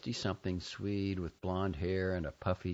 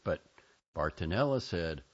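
usual speaking voice

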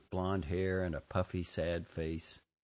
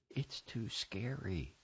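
soft, slow frightened whisper; hushed, drawn-out child's whisper; 7 dB quieter, slower than usual. A narrator's reading. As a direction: whisper it slowly like a scared child. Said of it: "She whispered".

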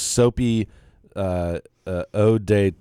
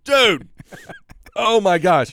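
startled shout; 10 semitones higher than usual. Real, not acted. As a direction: cry out, caught off guard. Sprayed with cologne unexpectedly.

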